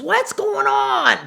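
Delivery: loud, high, drawn-out shriek; alarmed shout. Retold or imitated. Boss finding blood on her, alarmed shout. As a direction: act out a shocked yell, stretching the words.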